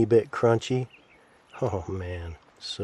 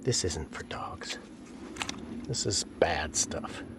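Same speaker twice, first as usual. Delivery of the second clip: 1 hushed teasing; 3 semitones lower, 6 dB quieter than usual. Real, not acted. Teasing dog, low hushed voice.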